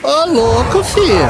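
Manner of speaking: sudden high rising exclamation; high surprised yelp. Real, not acted. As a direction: squeal it out in shock. Surpresa com inimigo no jogo. (grito agudo de surpresa)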